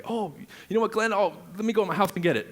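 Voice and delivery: eager homeowner. Retold or imitated. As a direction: play the proud seller rushing to fetch the card. Homeowner proudly offers business card.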